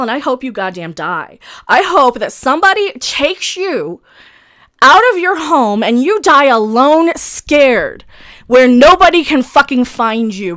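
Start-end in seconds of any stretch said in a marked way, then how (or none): 1.7-10.5 loud, heavy-stressed angry rant; furious cursing. Real, not acted. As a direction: curse the troll out at full voice.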